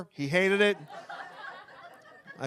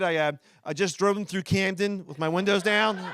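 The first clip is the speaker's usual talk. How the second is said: ordinary talk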